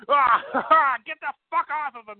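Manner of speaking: high, strained mock fury; Vince McMahon. Impersonating the boss when Backlund is out there, mock-furious. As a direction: roar it like a riled-up boss, hammed up. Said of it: "gets like he wants to fight somebody".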